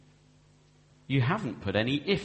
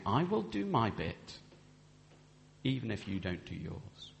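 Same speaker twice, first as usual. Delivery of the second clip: quiet vow; 5 semitones lower, 7 dB quieter than usual. Shown as a demonstration. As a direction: say it gently and humbly. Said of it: "We're saying".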